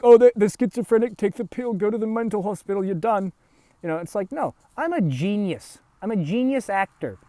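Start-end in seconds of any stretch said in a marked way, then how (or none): 0.0-3.3 mocking dismissive voice. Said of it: "the, oh, like".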